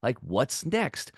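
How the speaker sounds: slow, savoring delight at the challenge; drawn-out eager ooh; mock excitement. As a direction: relish it like a gift, slowly. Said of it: "I'm like".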